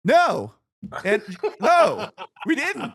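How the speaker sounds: high, loud laughing denial. Real, not acted. Answering the cohost about the refund, laughing.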